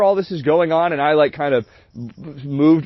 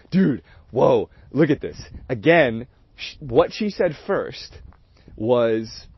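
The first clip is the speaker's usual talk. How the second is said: normal talking voice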